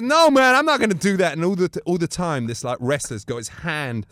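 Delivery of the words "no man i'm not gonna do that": high, loud imitated refusal; protesting frat boy. Frat-boy wrestler refusing Bruno, high mock protest. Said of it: "And it goes".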